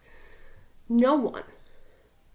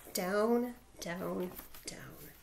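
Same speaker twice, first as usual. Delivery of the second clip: each DOWN lower and softer than the one before; stepping downward; 4 semitones lower, 6 dB quieter, much slower than usual. A narrator's reading. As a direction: sink step by step to a very low DOWN. Descending deep into the tunnel.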